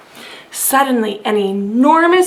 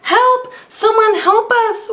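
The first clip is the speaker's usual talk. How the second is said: much higher, panicked cry; wailing for help; 8 semitones higher than usual. A narrator's reading. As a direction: call out in mock panic. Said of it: "wailed the animals".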